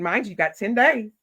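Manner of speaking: ordinary talk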